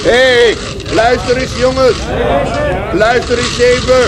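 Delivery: calling out. Acted. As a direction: call the lads over to listen. Calling a crowd to listen. (luid roepend naar de menigte)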